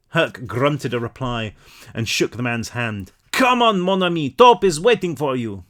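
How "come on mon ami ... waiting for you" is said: put-on higher, louder French accent. Baker waving the friends through, French accent. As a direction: beckon in a thick French accent. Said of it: "My accents are amazing".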